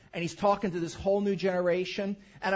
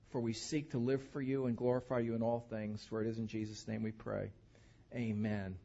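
prayer; 4 semitones lower than usual. Acted. Closing prayer.

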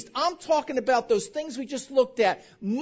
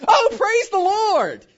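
slow, loud, high hammed-up hallelujah; gushing praise; 9 semitones higher, 8 dB louder, slower than usual. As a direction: overdo the churchy joy. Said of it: "their first reaction was".

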